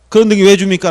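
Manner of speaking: a loud mock protest from a third party; mock complaint. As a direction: complain loudly, mock-indignant. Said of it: "라고 따지는 거야". (이해관계인이 큰 소리로 따지는 흉내)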